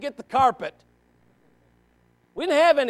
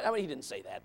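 ordinary delivery

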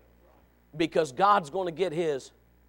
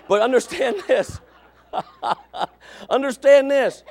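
words breaking through real laughter; laughing; 8 semitones higher, 7 dB louder than usual. Real, not acted. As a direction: try to get the line out while laughing. Laughs while preaching.

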